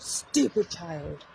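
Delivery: very slow, drawn-out retold scolding; remembered scolder. Remembered voice from childhood telling off a child, slow mock scolding. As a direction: imitate a scolder, stretching each word. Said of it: "I remember back to my childhood".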